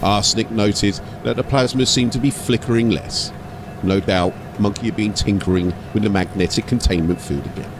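steady storyteller read of an ork tale; story narration. A narrator's reading. Narrator telling an ork story to listeners, storytelling voice. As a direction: carry the listener through the scene.

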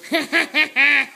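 being tickled. Real, not acted. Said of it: "she's laughing at you tickling her".

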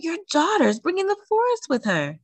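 high-pitched cry to a neighbour. A narrator's reading. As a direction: call it out high, in surprise. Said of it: "the lady cried".

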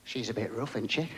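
half-whispered aside; dad hushing a remark about the girl; imitated hush. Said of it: "he whispered to me".

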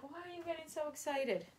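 high, soft voiced dog; dog's voice. Voicing her dog George, puzzled, high and soft. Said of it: "George is like".

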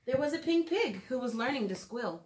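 lilting storybook read-aloud; gentle storytime narration for little listeners; reading to children. Acted. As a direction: read it like a bedtime picture book. Reads picture book to children.

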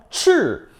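쯔 falling sharply from high; fourth tone. Shown as a demonstration. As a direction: drop 쯔 fast from the top. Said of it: "사성".

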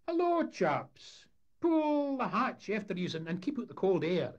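cheeky lad calling out to visitors; high, loud brash Scots greeting; Sonny Jim voice. Acted.

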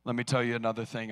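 usual speaking voice

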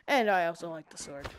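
usual speaking voice

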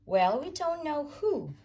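usual speaking voice

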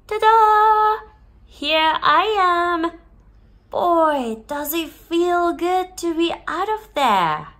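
high, loud ta-da burst; genie. Acted. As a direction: burst out grandly, overjoyed to be free.

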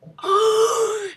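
high loud startled gasp; sharp gasp. Real, not acted. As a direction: suck in air in surprise. Reacting to game event.

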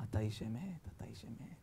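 very soft, low, humbled murmur; awed submission. Retold or imitated. Nimrod going down to Abraham's feet, hushed submission. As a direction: barely voice it, in reverence.

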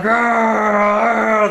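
loud, high, drawn-out "argh"; mock roar of frustration; frustrated growl. Friend stuck at red light after red light, frustrated roar. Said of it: "he got frustrated".

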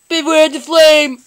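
loud imitation of a battle warning; mock-menacing copy; Charmander. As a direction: call out the threat loudly. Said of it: "Charmander said that".

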